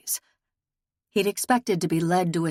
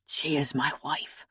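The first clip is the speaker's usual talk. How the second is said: said low and soft, near a murmur; a husband's quiet, aching admission; hushed and lower, holding back grief; 4 semitones lower, 5 dB quieter than usual. A narrator's reading. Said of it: "he said in a low voice".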